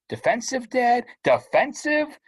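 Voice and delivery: high, incredulous repeated protest; indignant outburst. Acted. Teen outraged at dad calling him defensive, high and indignant. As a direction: throw the word back in disbelief. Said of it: "sinking to their capital letter level".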